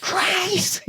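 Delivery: whisper scream. Retold or imitated. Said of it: "he's trying to whisper, scream her name".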